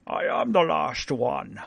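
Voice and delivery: lightly voiced Connery dragon, slow; a hint of Sean Connery as dragon. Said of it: "Sean Connery is the voice of the dragon".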